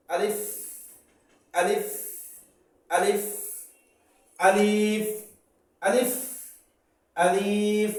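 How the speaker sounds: teacher calling out আলিফ over and over for students to repeat; drilled letter sounds. Shown as a demonstration. Teacher drilling a class on Arabic letters, loud repeated calls. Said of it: "আলিফ বলতে গেলে".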